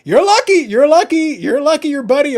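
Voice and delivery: high, loud mock telling-off; mock scolding. Host telling Eric he is lucky, in a high loud mock scold. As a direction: scold him in jest, loudly.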